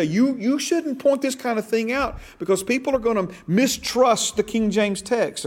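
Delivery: high-pitched mock objection; imitated objector. Voices objectors to his teaching.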